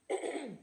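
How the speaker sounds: clears a bug from throat; rough throat clear. Real, not acted. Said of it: "excuse me, bug".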